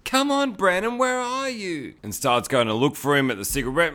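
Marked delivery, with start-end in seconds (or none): 0.1-1.9 high-pitched mock girl voice; girlfriend calling out. Girlfriend looking for her missing boyfriend, high mock calling.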